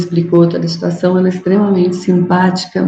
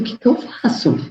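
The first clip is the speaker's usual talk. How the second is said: soft, whispered plea for help; frightened whisper; 4 semitones higher, 5 dB quieter than usual. Retold or imitated. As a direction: whisper it, scared stiff. Frightened question in danger.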